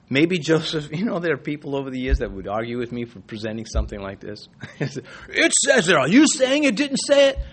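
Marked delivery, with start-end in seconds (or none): 4.8-7.4 mock critic. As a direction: play the outraged critic with a raised voice. Quoting critics who argue with him.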